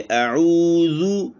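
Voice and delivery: slow chanted अऊज़ु with rounded lips; recitation demo. Shown as a demonstration. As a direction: draw out अऊज़ु like Quran recitation. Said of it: "होंठों को गोल करना है".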